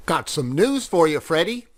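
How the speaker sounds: a hint of a sly fox. Fox John's dialogue.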